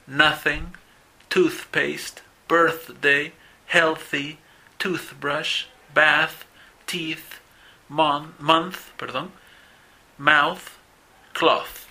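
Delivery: "Nothing" and "toothpaste" with an airy unvoiced th; voiceless th words. Shown as a demonstration. Teacher reads the voiceless column once so the class hears the difference, clear. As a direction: only air on the th of "mouth" and "cloth". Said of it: "voy a leerla una vez".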